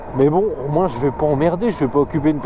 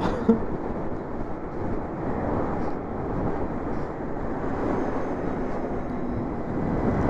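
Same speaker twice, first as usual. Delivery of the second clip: wheezing laughter; long breathy laugh; 8 semitones higher, 9 dB quieter than usual. Real, not acted. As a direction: laugh it off at length.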